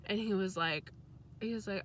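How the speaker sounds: usual speaking voice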